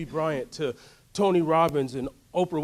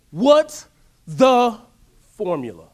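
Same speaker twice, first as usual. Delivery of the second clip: each word punched loud and slow, "What's the formula?" demanded; loud demanding question; 5 semitones higher, 11 dB louder, slower than usual. Shown as a demonstration. Preacher to crowd, demanding loudly "What's the formula?". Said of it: "I go on these little side tangents".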